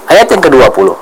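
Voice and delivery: ordinary talk, nothing marked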